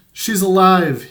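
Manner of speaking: loud, high shout of joy; joyful yell. A narrator's reading. Little boy seeing Carmen alive, yelling for joy. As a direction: yell it up to the sky. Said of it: "he yelled".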